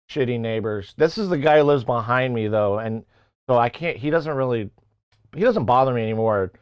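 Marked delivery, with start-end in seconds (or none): none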